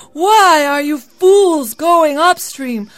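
loud, high, exasperated shout; tired, furious call. A narrator's reading. Labai scolds fools going upstream, tired and furious. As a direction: call out furiously, stretching the words. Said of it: "Labai called out, tired and furious".

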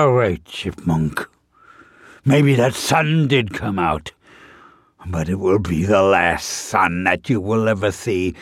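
growing menace from a big bear; menacing bear. Acted.

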